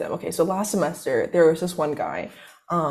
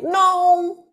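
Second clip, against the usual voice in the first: long, high-pitched mock "no"; hammed-up drawn-out denial; appalled refusal; 12 semitones higher, 4 dB louder than usual. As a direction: draw it out high in exaggerated protest. Retold appalled refusal to him.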